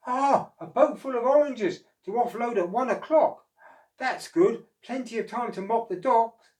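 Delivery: eager to start the day; wondering. Acted. Oscar reads office book.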